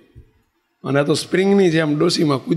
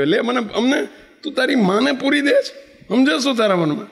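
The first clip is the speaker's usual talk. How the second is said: high, shrieking mock tirade; hammed-up angry old woman; furious scolding; 8 semitones higher than usual. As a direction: berate him for locking her in. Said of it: "જે ગાળ્યો દીધી".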